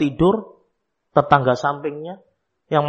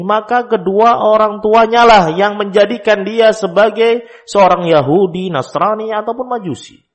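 raised, high-pitched sermon delivery; forceful preaching; 9 semitones higher, 7 dB louder than usual. Real, not acted. Preacher quoting hadith about parents shaping children, forceful preaching. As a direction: proclaim it to the congregation with force.

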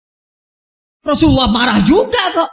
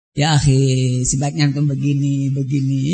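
gentle advice; 11 semitones lower than usual. Retold or imitated. An ideal ustadz calmly advising a brother, gentle and low. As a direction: ease into it like a kindly elder advising a brother.

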